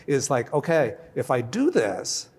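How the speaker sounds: normal talking voice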